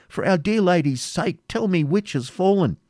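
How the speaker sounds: high, anxious entreaty; urgent plea. A narrator's reading. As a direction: beg desperately for the news. Ivanhoe begs for news.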